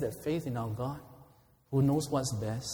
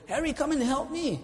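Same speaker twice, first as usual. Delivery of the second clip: cry for help; 10 semitones higher than usual. Acted. Wounded Bill calls to his friend Harry, high cry for help. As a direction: call out high like the wounded buddy. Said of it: "there was a cry".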